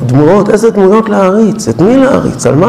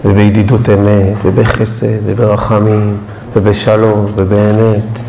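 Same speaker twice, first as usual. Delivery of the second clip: lower, soft and unhurried; prayerful guided reflection; 9 semitones lower, 4 dB quieter than usual. Acted. Rabbi guiding the congregation to light candles, low and soft. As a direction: lead them gently toward the candles.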